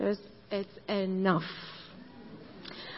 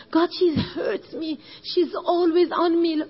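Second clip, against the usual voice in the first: hurt complaint; 5 semitones higher, 4 dB louder than usual. Retold or imitated. Jesse complaining to God about her sister, higher. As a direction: complain like a wounded child.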